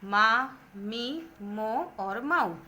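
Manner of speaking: मा, मी, मो, मऊ each drawn out very slowly; slow syllable demo. Shown as a demonstration. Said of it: "म पे ए की मात्रा". (मात्राएँ धीरे-धीरे बोलकर दिखाना)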